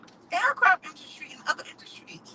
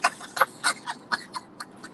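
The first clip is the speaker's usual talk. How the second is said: laugh; 5 dB quieter than usual. Real, not acted. Laughs at own joke.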